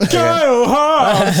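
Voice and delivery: slow, high hammed-up host intro; announcer voice. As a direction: boom the name like a game show.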